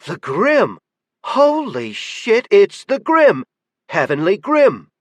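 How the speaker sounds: high, loud starstruck cry; awed excitement. A narrator's reading. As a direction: gasp it out in disbelief. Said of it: "The redhead gasped".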